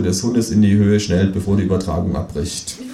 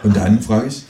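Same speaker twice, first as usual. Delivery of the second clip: plain reading voice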